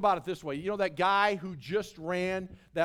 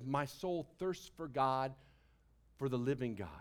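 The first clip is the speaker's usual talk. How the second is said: soft reading; 8 semitones lower, 7 dB quieter than usual. Real, not acted. Soft psalm quote.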